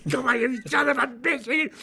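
high, loud, strained mock yell; sweaty shouted threat. Retelling the co-star's last take, a mock yell. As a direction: shout it like the twentieth exhausted take. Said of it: "Yaphet is sweating".

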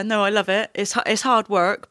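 usual speaking voice

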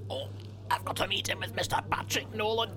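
a whispered, lightly voiced seagull; mock seagull voice. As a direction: whisper a silly impression of the seagull. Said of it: "He says".

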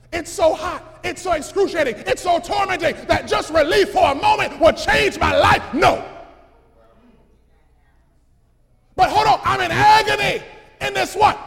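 loud, high anguished begging; tormented rich man. Acted. Rich man in the fire begs, loud anguished cry. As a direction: cry out from the flames.

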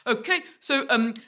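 high, flustered stammering presenter; imitated panic, suppressing laughter; mock panicked. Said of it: "really panicked".

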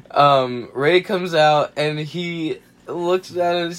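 ordinary talk

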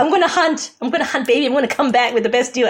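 high, eager mock bravado; gung-ho bargain hunter. Wife imitates husband off to bargain in Asia, gung-ho. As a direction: imitate a husband fired up for the haggle. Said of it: "for him it's a sport".

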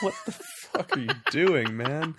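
laughing while asking in mock outrage; laughing disbelief. Reacting to Craig's accent, laughing. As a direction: crack up at the absurd line. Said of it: "I'm like".

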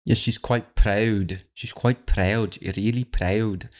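slow, drawn-out copy of her "quite proud"; mimicked posh "proud". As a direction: echo the clip's accent, stretching "proud". Mimics the speaker in the clip.

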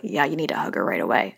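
lightly voiced stern inner brain; intellectual brain. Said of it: "my intellectual brain's like".

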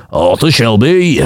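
slow, low Brummie growl; Brummie accent. Retold or imitated. Naming Birmingham, a slow low Brummie Arthur Shelby. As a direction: say it like a Peaky Blinder.

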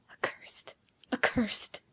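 whispered first, then half-voiced; muttered curse. A narrator's reading. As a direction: mutter it bitterly to yourself under your breath. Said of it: "muttered Giovanni, addressing himself".

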